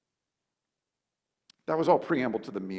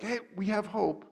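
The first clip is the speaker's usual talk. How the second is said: mock hopeful; 9 semitones higher than usual. Mock hopeful quote of disciples.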